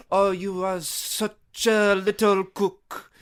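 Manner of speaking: put-on high, playful French accent that keeps climbing. Praising Ratatouille's rat chef in a high French accent. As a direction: coo at a little chef in an exaggerated French accent. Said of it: "You sounded so French".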